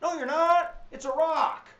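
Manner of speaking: no-nonsense, hammed-up rebuttal; mock stern refusal. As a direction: slap the idea down like a stubborn parent. Teacher mock-scolds the idea.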